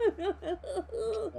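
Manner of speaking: giggling slow reply; high laughing thanks back. Real, not acted. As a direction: laugh through the words.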